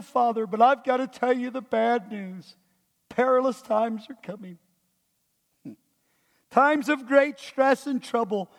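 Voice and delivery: mock-fearful, reluctant Bible reading; scared reading. Shown as a demonstration. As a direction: read it as dreaded bad news, apologetically. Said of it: "in a scared way".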